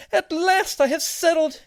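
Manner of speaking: very high, loud, slow joyful cry; a tiny voice rejoicing; happy shout. Acted. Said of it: "the seed shouted happily".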